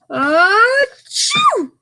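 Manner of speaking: loud, high acted sneeze; big sneeze. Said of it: "makes the bear".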